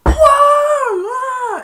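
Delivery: very high, loud wail; hammed-up terror; falling panic. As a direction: tumble off the plank. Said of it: "and he went".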